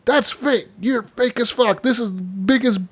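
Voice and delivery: high-pitched mock outrage; indignant heckler. Audience member calls the magician a fake, indignant. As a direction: heckle from the front row, imitating a doubter. Front-row heckler denounces the act.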